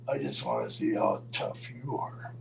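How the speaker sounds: low, measured threat; cool challenge. Retold or imitated. Roddy to Ole in the mirror, coolly challenging. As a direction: drop the voice low and challenge him. Driver coolly menaces back passenger.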